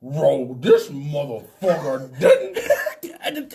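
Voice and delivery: loud, slow growled dog voice; talking dog. Acted. Police dog shocked by the passenger, slow growling disbelief. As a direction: drag the words out in a doggy growl. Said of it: "the dog was like".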